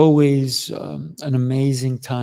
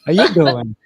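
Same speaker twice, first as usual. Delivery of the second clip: loud, higher, playful New Yorker; New York accent; 8 semitones higher, 6 dB louder, faster than usual. Pretend. Host joining the bit, mock New York accent. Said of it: "here's my bad New York accent".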